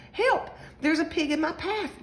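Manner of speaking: high-pitched holler for help. A narrator's reading. Lucy on her skateboard meets a pig, hollering. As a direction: cry out in storybook alarm. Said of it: "Lucy hollered".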